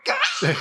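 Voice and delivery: a high frustrated Gah; frustrated shriek. Retold or imitated. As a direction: let out a shriek. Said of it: "and I'm like".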